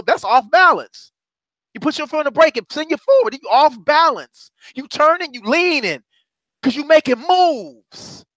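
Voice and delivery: high, punchy preaching beats. Acted. Preacher urging the congregation to make moves, fervent. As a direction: hammer the point home.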